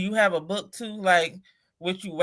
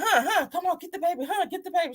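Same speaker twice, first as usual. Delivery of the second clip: mock panic; 10 semitones higher, faster than usual. Begging daughter to take baby.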